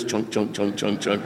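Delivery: quiet repeated chunk sounds, imitating a door bumping bags; soft chunk noises. Telling how the automatic door kept hitting his bags, soft mock chunk sounds. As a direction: mutter the door's chunk chunk softly.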